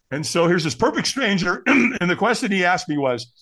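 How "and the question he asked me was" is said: ordinary delivery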